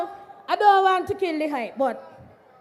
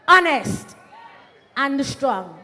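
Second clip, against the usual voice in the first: ordinary talk, nothing marked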